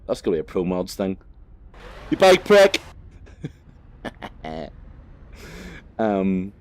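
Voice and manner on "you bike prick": high, loud playful yell; sudden hammed-up insult shout; mock road-rage shout. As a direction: yell it at a passing biker. Shouting at a biker in game.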